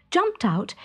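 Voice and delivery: ordinary delivery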